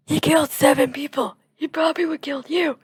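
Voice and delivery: retelling the dream, angry at the crowd defending the killer; angry retort. Retold or imitated. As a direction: snap back at the accusers, heated. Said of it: "I got so mad at them".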